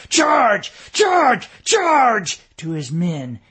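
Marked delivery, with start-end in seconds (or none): 0.1-2.4 very high, loud battle roar. A narrator's reading. Schoolteacher colonel roars his men into the charge.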